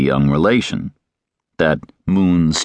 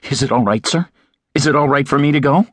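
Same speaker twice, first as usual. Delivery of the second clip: higher, quick anxious asking; eager demand; 8 semitones higher, faster than usual. A narrator's reading. Terry asking the judge, quick and higher. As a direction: rush the question, eager. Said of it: "Terence demanded".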